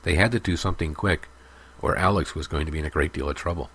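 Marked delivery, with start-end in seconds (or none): none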